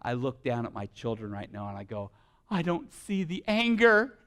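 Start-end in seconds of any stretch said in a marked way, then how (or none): 2.5-4.2 loud, high impassioned sermon; fiery preacher rising to a climax; preaching at full force. Acted. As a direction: build it like a pulpit call to righteous anger. Preaching about his children.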